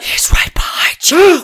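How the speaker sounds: slow, breathy horror-movie whisper; mock scary whisper; spooky whisper. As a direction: creep them out.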